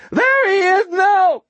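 thin, reedy, very high screech; frantic high-pitched demand; screeching homeless man. A narrator's reading. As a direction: screech it loudly in a reedy falsetto. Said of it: "the shrill whine of the homeless man".

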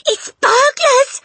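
very high, loud, drawn-out squawk; panicked hiss. A narrator's reading. Mother fears burglars, shrill panicked squeal. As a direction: squeal it in slow-motion panic. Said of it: "hissed the mother".

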